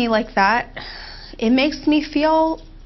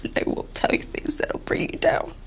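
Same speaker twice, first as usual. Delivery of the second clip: whisper; 7 dB quieter than usual. Real, not acted. Emotional promise to herself.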